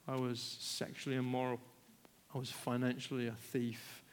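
usual speaking voice

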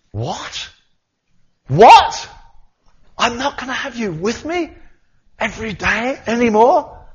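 high loud disbelieving cry; shocked, almost shouting in dismay; distraught shock. Pretend. Peter hearing Jesus will leave, shocked high cry.

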